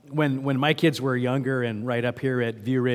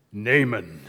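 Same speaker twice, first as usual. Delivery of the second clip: lower, put-on manly voice; 5 semitones lower than usual. Shown as a demonstration. Preacher naming the great commander to the congregation, manly voice. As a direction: say the name like a manly man. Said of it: "manly voice".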